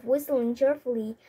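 plain reading voice

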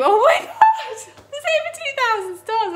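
high-pitched excited exclamation; amazed delight. Real, not acted. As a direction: squeal in real disbelief. Amazed at two thousand stars.

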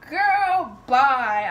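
loud, drawn-out brush-off; loud dismissal. Real, not acted. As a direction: wave her away with your voice. Dismissing the challenger.

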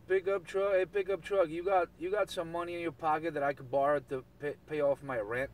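ordinary talk, nothing marked

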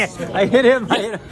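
laughing it out, high and loud; guilty giggle through the words. Real, not acted. Vlogger bumps a stranger, laughing apologetically.

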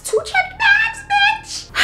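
very high-pitched indignation; outraged squeal. Retold or imitated. Niece who paid for the ticket, outraged squeal.

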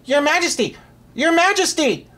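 high, urgent call; crying out. A narrator's reading. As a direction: call out frantically to the king above. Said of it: "cried out Sir Ulrich".

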